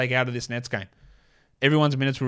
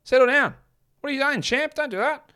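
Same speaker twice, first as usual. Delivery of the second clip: much higher, whiny mock voice of a Kemba Walker fan telling Josh off; mocking annoyed listener; 12 semitones higher than usual. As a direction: parody a fan pleading with the host to calm down.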